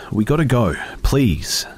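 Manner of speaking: high, loud pleading; urgent pleading. A narrator's reading. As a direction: beg her to leave now. Begging urgently.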